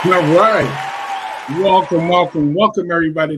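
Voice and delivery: loud, upbeat radio welcome; hype host welcome. Real, not acted. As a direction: pump up the listeners as you open. Host opens the radio show.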